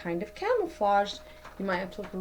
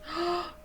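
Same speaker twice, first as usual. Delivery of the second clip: surprised gasp; 8 semitones higher, 6 dB quieter than usual. Acted. Something suddenly jumps.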